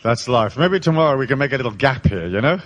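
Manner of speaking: normal talking voice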